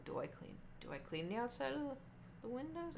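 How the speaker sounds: soft, hushed, unsure question; unsure whisper. Retold or imitated. Waitress unsure about cleaning the windows, hushed. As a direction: whisper it to yourself, confused.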